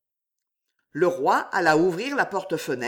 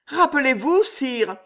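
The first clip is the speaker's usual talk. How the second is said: appel aigu et plus fort, montant; rappelle-lui ce souvenir avec élan; Juliette passionnée; 7 semitones higher, 4 dB louder than usual. A narrator's reading. Juliette voiced by narrator. (Juliette rappelle la fête au roi, voix aiguë et fervente)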